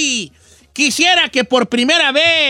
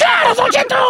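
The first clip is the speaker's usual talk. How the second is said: high squeaky cartoon voice; magic sneakers voice. Shown as a demonstration. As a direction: chirp it like a tiny cartoon shoe. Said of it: "A ver, hágala".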